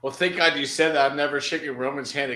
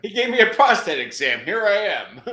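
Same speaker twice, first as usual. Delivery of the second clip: loud, higher-pitched comic delivery; stand-up comic impression; 5 semitones higher, 5 dB louder than usual. Showing the co-host how he presents stuff, loud comic impression. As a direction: punch it out as an old-school stand-up. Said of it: "I think I can do him a little bit".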